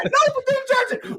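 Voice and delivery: extremely high, loud squeal; mock frantic recruit denial. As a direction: shriek the denial at attention, exaggerated.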